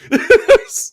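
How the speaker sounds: sudden high squealing laugh; high burst of laughter. Real, not acted. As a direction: crack up at your own story. Laughs at own story.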